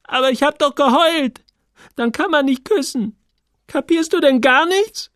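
put-on high, loud, indignant girl's voice; angry girl. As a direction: snap at him as the upset girl. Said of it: "Sie sah ihn wütend an".

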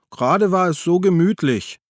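high, loud tipsy whine; complaining. A narrator's reading. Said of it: "beschwerte sich Gabi". (maulend, quengelig)

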